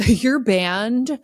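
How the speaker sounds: ordinary talk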